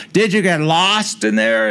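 slow, high call across the house. Retold or imitated. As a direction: call out to him in the kitchen, drawn out. Star calling out from other room.

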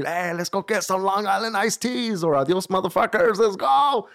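high-pitched party call rising to the end; hyped partygoer. Retold or imitated. Voicing hyped partygoers in the club.

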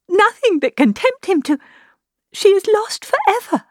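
high, distressed voice, climbing toward despair; rising pitch, halting with distress; anguished confession. A narrator's reading. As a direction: break the dreadful news in mounting anguish. Distressed confession of sister's elopement.

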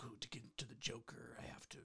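whispered tortured artist voice; brooding anguished artist. Acted. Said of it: "my tortured artist voice".

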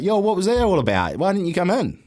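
high, puzzled mock query; confused mate. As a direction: ask like a baffled flatmate. Neeps confused, retold quote.

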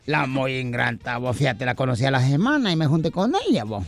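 grumbling buddy. Acted. DJ complaining to his friend about his wife, low grumble. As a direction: grumble in a deep put-on voice. Plays the friend DJ telling woes.

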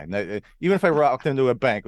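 usual speaking voice

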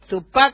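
Tupá with the accent on the closing A. Shown as a demonstration. Host repeats the trio's name, Tupá stressed on final A. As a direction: land firmly on the A ending Tupá. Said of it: "con acento en la A".

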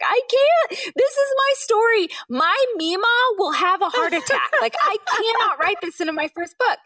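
very high, strained pleading protest; pleading refusal. Retold or imitated. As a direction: beg them to understand, near tears.

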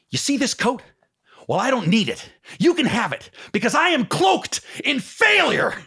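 high, loud, tearful Jerry Maguire; Jerry Maguire impression. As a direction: pitch it up and loud, near tears, cloaked in failure. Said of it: "I love when he says".

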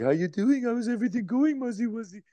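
put-on high, sing-song baby talk to a grown man; aunt's baby talk. Aunt talking to her grown nephew in high baby talk. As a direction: coo at him like he's a toddler. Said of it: "who talks to me like I'm a baby".

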